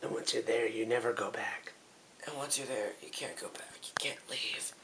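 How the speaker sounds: breathy, very quiet whisper; hushed warning. Pretend. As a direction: whisper it like an ominous mock warning.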